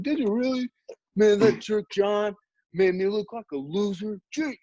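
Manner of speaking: high-pitched, exasperated imitation; mock angry. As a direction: act out a friend's outraged grumble, pitched high. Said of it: "then he got mad".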